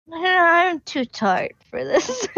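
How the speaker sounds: unimpressed. Acted. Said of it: "stares at everyone unimpressed".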